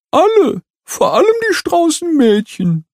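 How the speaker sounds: high, sorrowful complaint; sad. A narrator's reading. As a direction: say it dejectedly. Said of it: "meint Nolo betrübt".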